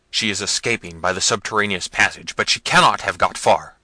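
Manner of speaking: loud, harsh order; shouting prince. Acted. Manfred urging servants after fleeing Isabella, shouting. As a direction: yell it down the vault.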